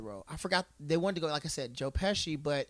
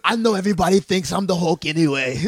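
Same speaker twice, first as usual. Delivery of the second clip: Arnold impression; 11 dB louder than usual. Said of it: "He's like".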